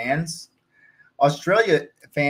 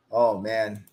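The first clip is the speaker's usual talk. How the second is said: ordinary talk, nothing marked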